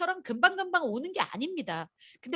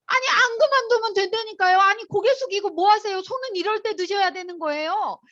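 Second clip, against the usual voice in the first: higher, louder playful scolding of the crowd; loud urging; 5 semitones higher, 4 dB louder than usual. Real, not acted. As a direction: rouse the room, chiding them to raise hands. Urging silent audience to commit.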